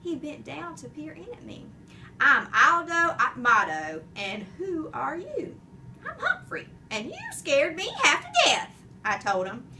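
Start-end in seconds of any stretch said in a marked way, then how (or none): 2.2-5.6 hearty janitor. A narrator's reading. Janitor introduces himself. 6.1-8.7 much higher, squeaky little hamster; high, breathless hamster protest; tiny hamster voice. A narrator's reading.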